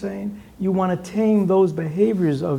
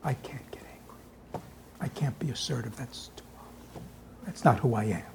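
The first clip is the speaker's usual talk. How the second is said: mock meek person fading to a whisper; hammed-up sugary whisper of a pushover; meek, sweet persona; 2 semitones lower, 8 dB quieter than usual. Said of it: "Or people say".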